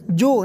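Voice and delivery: جو with a j and o vowel; wrong jo reading. Shown as a demonstration. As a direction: say جو with tongue kept in. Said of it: "نہیں".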